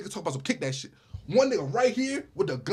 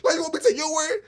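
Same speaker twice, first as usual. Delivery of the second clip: incredulous; 9 semitones higher than usual. Real, not acted. Incredulous rhetorical question.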